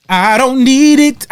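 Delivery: high, loud, slow "I don't need it"; hammed-up proud refusal; dramatic refusal. Repeated reference line, dramatic.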